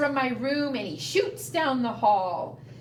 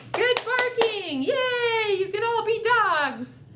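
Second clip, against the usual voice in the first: excited praise; 9 semitones higher, 4 dB louder than usual. Real, not acted. Praising kids after singing.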